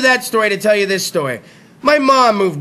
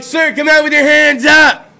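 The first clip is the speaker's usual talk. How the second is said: police shout; 6 semitones higher, 5 dB louder than usual. Retold or imitated. Cops yelling at suspect.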